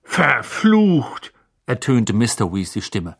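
high, excited fatherly voice; eager wizard dad voice. A narrator's reading. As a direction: play a curious, cheerful father. Mr Weasley voiced as character.

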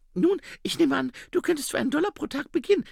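very high female character voice; Saccharissa. Acted. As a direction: pitch it right up, hiring briskly. (sehr hohe Frauenstimme)

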